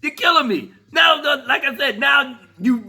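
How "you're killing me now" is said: ordinary talk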